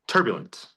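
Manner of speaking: turbulent said TUR-byu-lent; stress on first syllable. Shown as a demonstration. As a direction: hit TUR in turbulent, let the rest fall. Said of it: "The first syllable".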